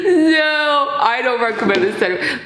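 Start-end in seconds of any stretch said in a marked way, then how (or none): 0.0-1.0 high-pitched laugh, almost crying; helpless shrieking laughter; high laughing fit. Real, not acted. Laughing fit after challenge.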